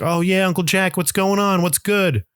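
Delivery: put-on high-pitched little-kid greeting; imitating himself as kid. Kid greeting a family friend in the morning, imitated high child voice. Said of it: "That's how I talked when I was a kid".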